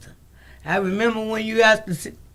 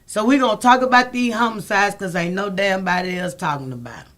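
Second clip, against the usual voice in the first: ordinary talk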